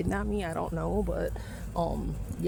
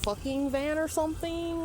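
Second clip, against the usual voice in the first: high, mocking imitation of the guys; 8 semitones higher than usual. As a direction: mimic them, sing-song and much higher. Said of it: "They're like".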